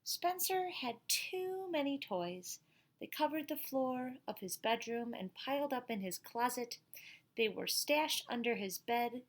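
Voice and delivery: put-on lively storybook voice for kids; reading to children. A narrator's reading. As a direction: read it out to the class like a picture book. Reads picture book to class.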